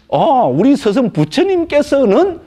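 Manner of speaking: acted disciple citing the master, high and slow; 공손한 제자 흉내. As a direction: draw it out reverently, pitched well up. 제자의 모범 답변을 연기. (스승을 받드는 제자처럼 높고 천천히)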